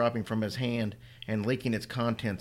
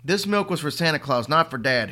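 loud, higher furious roar; 5 semitones higher, 7 dB louder than usual. Acted. Boy roaring at his mother, furious. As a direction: roar it with every word hammered. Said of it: "Henry roared".

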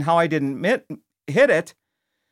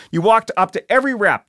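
ordinary talk

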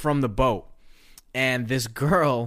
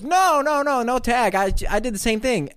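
put-on high, loud, giggly girl on the boat; girl on boat laughing; 10 semitones higher, 3 dB louder than usual. Girl on the boat admitting she overpaid, high and giggly. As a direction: play a girl laughing at her own mistake. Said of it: "And she goes".

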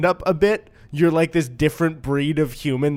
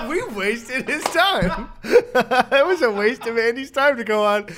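real laughter through the words, pitched high; laughing; 9 semitones higher, 4 dB louder, slower than usual. Real, not acted. Host joking with co-host about wasting Andy's time, laughing. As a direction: crack up while saying it. Both hosts cracking up at themselves.

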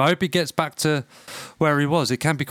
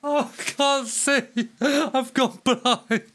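crying with laughter; 11 semitones higher, much slower than usual. Real, not acted. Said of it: "I'm crying".

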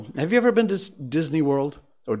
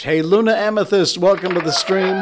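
higher, louder cheerful welcome; excited greeting; 7 semitones higher, 7 dB louder than usual. Real, not acted. Host welcoming a late viewer in chat, excited. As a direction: call out a bright hello to the viewer.

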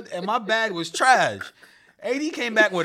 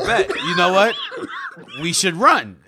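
laughing shout; 4 dB louder than usual. Retold or imitated. Laughing decision.

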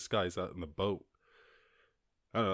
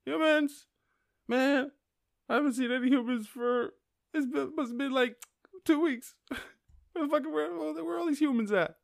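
much higher, louder dolphin peering about for humans; puzzled dolphin voice; 5 dB louder than usual. Pretend. Podcaster voices a dolphin searching for absent humans, high and bewildered. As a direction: call out as a bewildered dolphin missing people. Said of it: "You're like".

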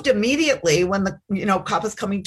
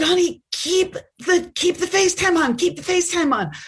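frantic pleading; 9 semitones higher than usual. Retold or imitated. Frantic mom on FaceTime with son.

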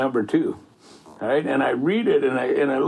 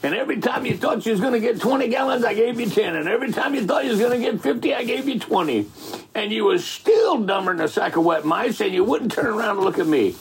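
exasperated God; 7 semitones higher than usual. Acted. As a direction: preach it up high like God scolding. God rebukes rejecting people.

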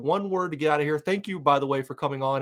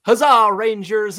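high, loud, slow huzzah; loud rally cry; 7 semitones higher, 8 dB louder, slower than usual. Real, not acted. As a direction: call out to the Rangers fans. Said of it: "It's the word huzzah".

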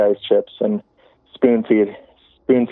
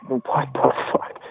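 slow, breath-only whispered reaction; hushed disbelief; 5 semitones higher, 6 dB quieter, slower than usual. Retold or imitated. Caller reliving seeing the ambulance rock by itself, hushed disbelief. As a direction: let it out slowly under your breath, spooked. Retelling fright at rocking ambulance.

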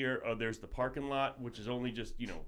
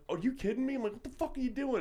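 high, incredulous, a bit exasperated; can't believe the raccoon stays; mild mock outrage at the raccoon; exasperated disbelief; 9 semitones higher than usual.

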